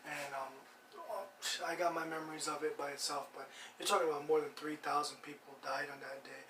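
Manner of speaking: soft, subdued tribute; solemn remembrance. Real, not acted. Host remembering September eleventh victims, solemn and hushed.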